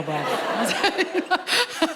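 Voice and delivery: ordinary talk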